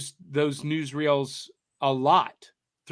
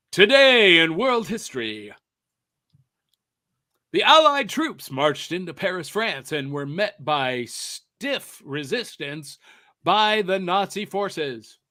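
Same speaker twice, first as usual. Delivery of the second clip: radio DJ voice; 5 dB louder than usual. Shown as a demonstration. Host demonstrating his radio announcer voice. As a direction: boom it out like a vintage radio newsreader. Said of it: "That's my radio D J voice".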